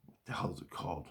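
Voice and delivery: fast, breathy whisper to himself; whispered aside. Real, not acted. Searching his memory for the camp's name, whispered fast. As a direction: mutter it under the breath while searching for the word.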